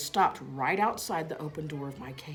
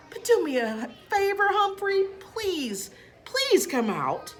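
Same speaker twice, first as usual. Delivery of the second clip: higher, coaxing plea, stretching the last please slowly; pleading; 5 dB louder, slower than usual. A narrator's reading. Principal coaxes the hidden hamster out, pleading. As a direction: beg the hamster gently to come out.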